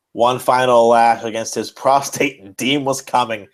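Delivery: mock dramatic reading. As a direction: read the climax like a melodrama, trying not to laugh. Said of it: "this is where it gets good, folks".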